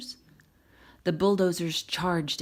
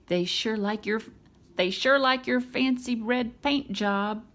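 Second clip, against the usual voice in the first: Mater telling; 5 semitones higher, 3 dB louder than usual. Acted. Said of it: "Mater told Lightning".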